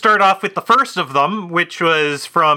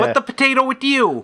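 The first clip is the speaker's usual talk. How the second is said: gangster voice; 6 semitones higher than usual. Pretend. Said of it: "Italian-American gangster sort of thing".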